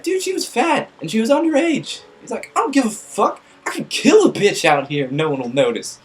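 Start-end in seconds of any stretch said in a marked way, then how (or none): 0.0-2.0 exasperated friend. Retold or imitated. Friends arguing with drummer. 2.6-6.0 put-on drunk rant. Drunk drummer ranting.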